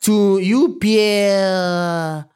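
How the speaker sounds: drawn-out mock French, with pauses; overdone French, lingering on the names; bad French accent. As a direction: double down on a fake French accent. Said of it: "I fell into that accent".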